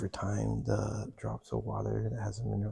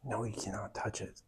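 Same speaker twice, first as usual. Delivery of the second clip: whispering; 5 dB quieter than usual. Real, not acted. Whispering in a cave.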